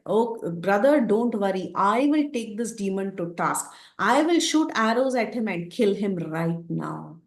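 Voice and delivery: usual speaking voice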